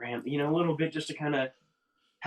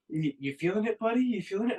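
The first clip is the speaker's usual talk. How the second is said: checking in; 9 semitones higher than usual. Retold or imitated. Friend checks on him as drugs hit.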